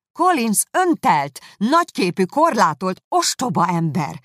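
loud, emphatic list of insults; heated condemnation. A narrator's reading. Elizabeth condemning Collins to her sister, heated. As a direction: hammer each adjective, starting high and coming down.

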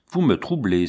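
usual speaking voice

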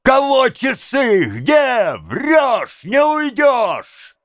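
high shouted alarm; frightful wailing shout; terrible howl. A narrator's reading. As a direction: howl it out high, stretching the last words. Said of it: "и она взвыла страшным голосом".

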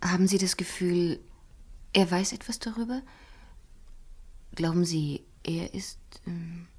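hushed, anxious whisper; a secretive whisper, afraid of being overheard. Acted. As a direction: lean in and whisper the worry. Anxious secret question about suspect.

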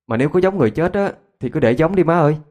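lightly voiced frightened child; meek child's reply. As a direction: answer mother in a high, pleading voice. Scared child answers scolding parents.

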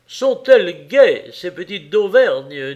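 loud, amused remark; hearty exclamation. A narrator's reading. Husband at the window about noisy neighbours, hearty exclamation.

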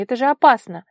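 loud, high, stern exclamation; stern warning. A narrator's reading. Vara scolds the girl out at night, sternly. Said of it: "строго спросила она".

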